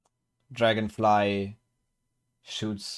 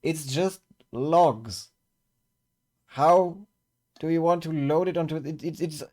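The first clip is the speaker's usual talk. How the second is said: higher-pitched, flustered disbelief; exasperated protest; 8 semitones higher, 4 dB louder than usual. Acted. Peter objects to loading logs.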